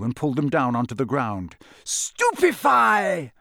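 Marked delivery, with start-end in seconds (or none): 1.8-3.3 high, long-drawn battle cry; roared spell. A narrator's reading. Twenty wizards firing a spell at once, roaring. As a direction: roar out the incantation slowly. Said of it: "roared twenty voices".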